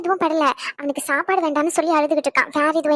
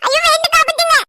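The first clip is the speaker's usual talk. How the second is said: put-on much higher and louder frightened little boy; scared child cry; 11 semitones higher, 4 dB louder than usual. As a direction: shriek to stop the slap. Child about to be hit. (அடி வாங்கப் போகும் குழந்தையின் பயந்த அலறல்)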